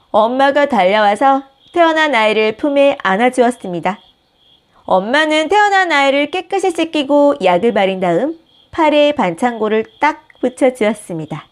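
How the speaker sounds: ordinary delivery, nothing marked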